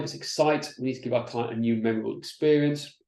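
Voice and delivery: normal talking voice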